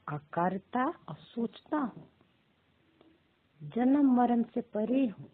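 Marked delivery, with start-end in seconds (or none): none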